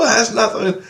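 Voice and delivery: very high, worked-up re-enacted shout; retold yelling. Dreamer yells at Grace about the boss, retold shout. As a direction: protest in a high-pitched yell. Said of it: "I'm yelling at Grace".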